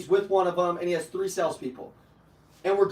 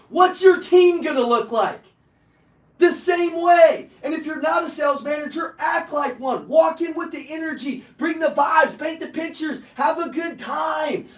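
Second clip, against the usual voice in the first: loud, high-pitched coaching call to action; rallying shout; 6 semitones higher, 5 dB louder than usual. Real, not acted. Sales coach firing up his audience, rallying shout. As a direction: pump up the room like a sales coach.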